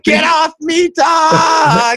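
high-pitched mock outburst; mock protesting shout. Friend fending off nagging co-host, mock protesting shout. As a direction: yell it high, playing harassed.

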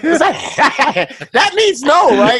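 high, loud laughing disbelief. Real, not acted. Friend laughing at the Kenyan friend's reply, high laughing disbelief. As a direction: laugh through the words in disbelief.

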